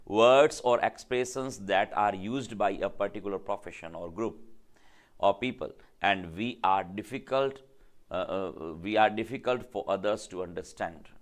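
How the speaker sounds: slow, deliberate dictation of a definition. Shown as a demonstration. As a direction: read it out slowly so students can write it down. Dictates definition slowly to class.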